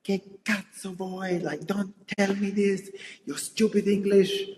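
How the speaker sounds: lightly voiced annoyed boyfriend; imitating partner. Mimicking her Italian boyfriend's annoyed retort. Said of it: "he's like".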